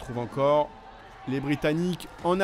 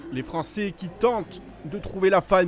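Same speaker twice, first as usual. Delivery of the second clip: ordinary talk, nothing marked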